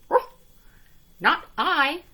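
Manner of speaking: high yappy barks then a loud refusal; a little dog saying no. A narrator's reading. Dog refusing the hen, loud yappy. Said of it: "said the dog".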